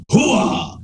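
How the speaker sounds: high barked hoo-ah; Al Pacino hoo-ah. Pretend. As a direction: bark it like Pacino. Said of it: "Al Pacino is Alfred".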